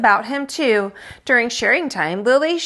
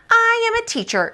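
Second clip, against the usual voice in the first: put-on much higher, proud child voice; little girl playing teacher; 13 semitones higher than usual. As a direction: announce it grandly to baby brother.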